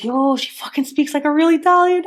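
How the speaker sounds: amazed. Retold or imitated. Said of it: "I'm like".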